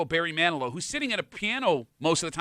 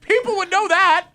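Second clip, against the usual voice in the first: mock scolding; 9 dB louder than usual.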